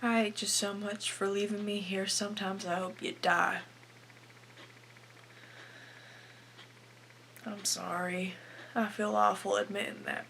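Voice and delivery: subdued, guilty admission held slightly back; hushed confession. Acted. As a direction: softly confess a bitter, guilty truth. Acted confession in a monologue to Howard.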